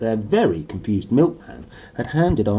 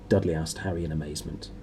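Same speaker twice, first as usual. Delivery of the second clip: ordinary delivery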